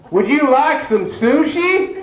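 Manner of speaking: high, slow, every word spelled out; slow overenunciated. Pretend. Mimicking people who talk slowly to the hard of hearing. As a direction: talk like to someone who can't hear.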